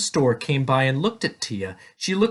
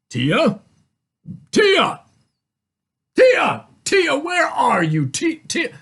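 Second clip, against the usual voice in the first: questioning first, then high, loud calls; searching father calling out; 14 semitones higher, 5 dB louder, slower than usual. A narrator's reading. Father looks for his daughter in the store, anxious calling. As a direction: call the name with growing urgency.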